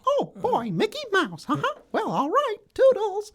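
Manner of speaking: very high cartoon falsetto; Mickey Mouse. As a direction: squeak it out as a chirpy cartoon mouse. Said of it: "Mickey Mouse for Radio Disney".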